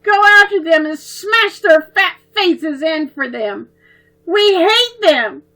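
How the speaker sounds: put-on high, loud, furious child, slowing on hate; angry yell. A narrator's reading. Little girl demanding revenge on the bullies, yelling. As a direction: rage it out through tears.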